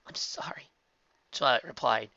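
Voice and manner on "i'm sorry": hushed apology; tremulous whisper. A narrator's reading. Twilight apologizes to her friends, whispered. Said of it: "voice growing tremulous".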